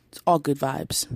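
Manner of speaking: usual speaking voice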